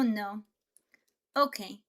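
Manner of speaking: usual speaking voice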